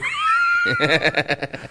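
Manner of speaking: loud, high-pitched burst of laughter. Real, not acted. Panelist laughs at the Ronaldo Esper joke, loud laugh.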